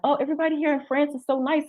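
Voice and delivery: high, quick mock gushing; gushing newcomer. Newcomer praising France to locals, gushing. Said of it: "when this lady said".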